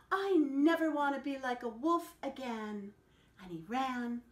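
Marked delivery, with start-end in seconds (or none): 0.1-2.9 high, loud, breathless run; panting. A narrator's reading. Mocha the dog racing down the mountain, panting. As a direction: gasp it out while fleeing. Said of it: "he panted".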